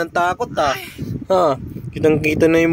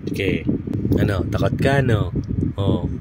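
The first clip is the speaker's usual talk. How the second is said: softer, lower murmured teasing; hushed teasing; 8 semitones lower, 6 dB quieter than usual. Real, not acted. Mother quietly teasing the noisy baby at bedtime. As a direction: keep it hushed like someone trying to sleep.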